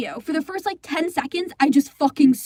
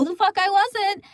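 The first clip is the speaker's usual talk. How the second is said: a shouted, half-crying retort; angry outburst; 6 semitones higher than usual. Real, not acted. Girl furious at her castmate, shouting. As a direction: snap back that you were not. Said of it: "made me even more angrier".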